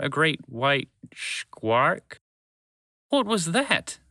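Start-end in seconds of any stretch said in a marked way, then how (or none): 3.1-4.0 high-pitched, alarmed cry; shouted question. A narrator's reading. Wheezy after escaping the squark, shouting. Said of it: "Wheezy shouted".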